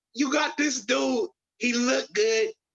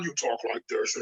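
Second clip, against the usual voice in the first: "like this" dropped low and much softer; suit-on Batman voice; 10 semitones lower, 4 dB quieter than usual. Shown as a demonstration. Showing the co-host the Batman suit voice, low and hushed. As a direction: sink into a low, hushed hero voice for "you talk like this". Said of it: "and then when he put the suit on".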